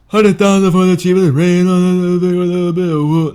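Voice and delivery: high, loud, slurred rapper voice; mocking a mumbled, slurred rap delivery; mumbling Future impression. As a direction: mush the words like a mumble rapper. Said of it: "so Future, repeat after me".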